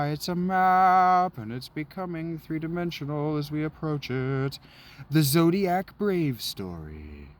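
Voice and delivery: hammed-up announcer; mock trailer voice. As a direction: narrate the map like an epic. Mock narrating the map scene.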